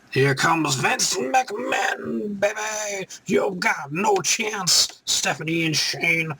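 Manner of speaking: high, slow, drawn-out ring announcer call; hype announcer. Pretend. Fan hypes the boss walking out, in a high, slow announcer call. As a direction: stretch every word like you're announcing the boss. Hyping Vince McMahon's entrance.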